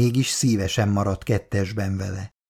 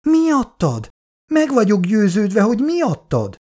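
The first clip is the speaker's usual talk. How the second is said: high-pitched bitter insistence; 9 semitones higher than usual. A narrator's reading. Piri telling her friend who is the cause, bitter. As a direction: throw it back with bitterness. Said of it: "válaszolta keserűen Piri".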